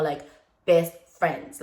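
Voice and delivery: usual speaking voice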